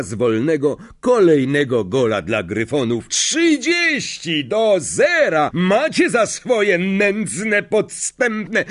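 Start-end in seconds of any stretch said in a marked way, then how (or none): 3.1-8.6 much higher, slow gloating shout; gloating goal call. Acted. Lee taunting the Slytherins, gloating. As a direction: rub it in.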